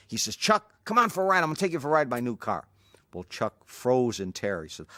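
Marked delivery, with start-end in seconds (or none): 0.4-2.7 much higher and louder, fast and keen; eager invitation. Retold or imitated. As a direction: rush the offer, all enthusiasm. Bruce eagerly inviting for ride.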